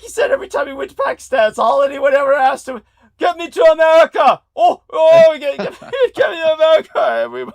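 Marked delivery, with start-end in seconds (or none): 3.2-7.5 loud, much higher, pleading yell; mock begging shout. Relatives begging Salman for America, shouted mock plea. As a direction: beg like a desperate crowd. Mimicking Pakistanis begging him.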